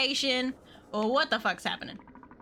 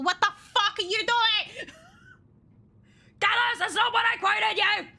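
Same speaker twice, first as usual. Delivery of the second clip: high, loud laughing scold; mock outraged scolding; 10 semitones higher, 3 dB louder than usual. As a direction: scold a fan who overpaid. Mock scolding a generous fan.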